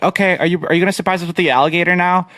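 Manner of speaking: put-on high, quick kid voices, lightly done; eager siblings pressing for the surprise. Siblings asking the big brother, lightly voiced eager kids.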